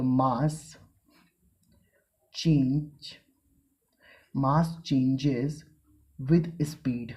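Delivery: slow, spaced-out dictation pace; teacher dictating to the class; dictating notes. Shown as a demonstration. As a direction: read it out word by word for students to copy. Dictating the next point.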